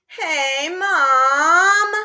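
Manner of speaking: high, drawn-out whining call; whine. Shown as a demonstration. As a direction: whine at mom like a kid. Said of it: "I whined".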